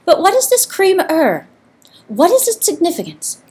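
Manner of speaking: high, timid little mouse voice; squeaky mouse. A narrator's reading. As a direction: ask like a trembling mouse. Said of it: "the mouse squeaked".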